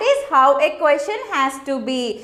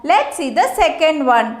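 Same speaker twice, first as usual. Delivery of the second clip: ordinary talk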